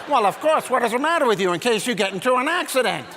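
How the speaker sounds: high, loud indignant mom voice; as if logical. Retold or imitated. Mom amazed her son questions her reasoning, indignant certainty. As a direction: scold as though it's perfectly obvious. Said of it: "like it's logical".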